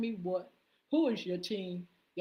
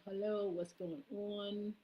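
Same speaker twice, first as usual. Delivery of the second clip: usual speaking voice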